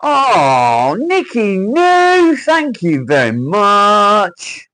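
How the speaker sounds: very high, drawn-out yelled thank-you; over-the-top squealed gratitude; whooping thanks. Real, not acted. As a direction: stretch every word into a huge celebratory shout. Celebrating a viewer's support.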